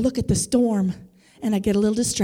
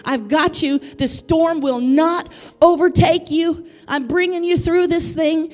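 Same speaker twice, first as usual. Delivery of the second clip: driving, emphatic sermon delivery; impassioned preaching; 5 semitones higher, 6 dB louder than usual. Real, not acted. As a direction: build the promise with fervour for the congregation. Preaches, voicing the Father's promise.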